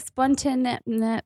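"Spontan-" tripped over, then given up on; mock failed attempt at saying "Spontan-"; retold stumbling. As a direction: start "Spontan-", get tongue-tied, then drop it. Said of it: "I could not say it last week".

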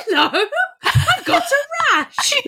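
high, slow and laughing patient; upbeat patient. Pretend. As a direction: play a giggly patient with a rash. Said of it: "You're very upbeat for a patient".

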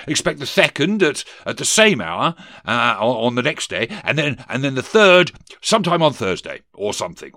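loud, halting, muddled proclamation; blustering ghost. Acted. Ghost fumbles the schedule for Scrooge, loud and hesitant. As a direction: stumble through it at full volume.

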